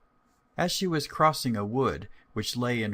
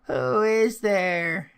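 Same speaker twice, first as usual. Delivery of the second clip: put-on higher, louder, slow old woman calling through the door; old grandmother; 9 semitones higher, 6 dB louder, much slower than usual. Grandmother answering the knock, slow high call. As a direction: call out like a frail granny. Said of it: "said some voice in the house".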